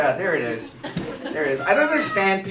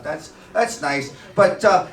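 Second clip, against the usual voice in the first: normal talking voice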